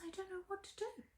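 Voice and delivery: small, high, soft voice; helpless admission. Acted. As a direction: confess it softly, pitched high. Phyllis at a loss on stage.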